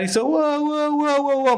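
high-pitched crowd whoops; imitated crowd whoop. Comic imitating the audience cheering him on, high whoops.

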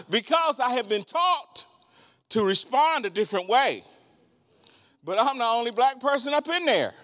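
high, laughing sermon run; excited preaching. Acted. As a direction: carry the crowd with the joke. Preacher laughing about his temptation.

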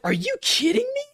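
incredulous high cry at the game; outraged disbelief. Retold or imitated. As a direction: shriek it like you just got robbed. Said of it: "I was like".

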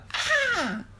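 a high, loud shriek cutting the dark; sudden spooky shriek. Acted. Dad scares his child with a spooky forest shriek. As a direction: shriek out of nowhere to startle. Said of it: "他突然听到".

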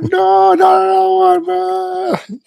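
very high squeal of protest; hammed-up kid squeal; shrieking kid. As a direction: shriek like a betrayed little boy. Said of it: "son protests second needle".

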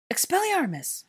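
high, loud spell-casting cry; cried out. A narrator's reading. Hermione disarming the attacker, crying out. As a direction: fling the spell out at full voice. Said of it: "Hermione cried".

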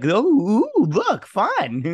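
high, loud, delighted monster voice; a hunter toying gleefully with prey; playful Predator. Retold or imitated. Voicing the Predator toying with its food, gleeful.

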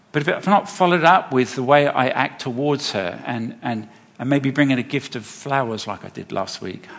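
ordinary talk, nothing marked

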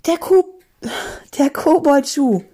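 high, stammered exclamation; alarmed high outburst; urgent cry. Acted. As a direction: blurt it out high, catching on the word. Panicked cry in the story.

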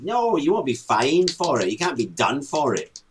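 normal talking voice

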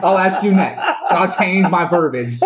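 talking through real laughter; laughing. Real, not acted. Host laughing after being interrupted, laughing through words. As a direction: laugh while taking it back.

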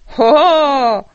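loud, high jeering call; knights calling. A narrator's reading. Knights jeering at the dragon on the walls, loud. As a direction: hurl the call up at him. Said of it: "volali rytíři".

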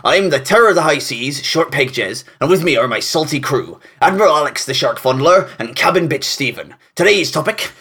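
gruff swaggering pirate captain. Acted. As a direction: growl it like a sea captain. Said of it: "with those voices".

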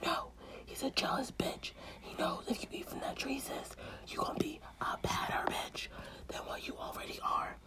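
whispered temptation from the snake. Acted. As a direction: lure her in a whisper.

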